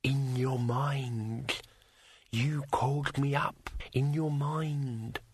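slow, soft, unvoiced dragon whisper; whispery telepathic voice. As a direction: whisper patiently like a mind-voice. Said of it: "thought the dragon patiently".

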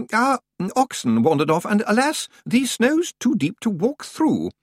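high, hesitant, distracted old librarian; Senior Librarian Swinsley. A narrator's reading. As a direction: mumble it while rummaging, fluttery and high.

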